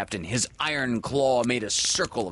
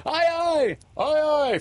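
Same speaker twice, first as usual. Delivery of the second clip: high, louder answering cry; eager calling; 5 dB louder than usual. A narrator's reading. Boys answer Peter across the lagoon, calling eagerly. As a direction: call back keenly, pitched very high.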